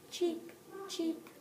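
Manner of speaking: "cheep, cheep" piped high like a bird. Shown as a demonstration. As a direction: chirp "cheep" high and light. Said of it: "in this book they say cheep".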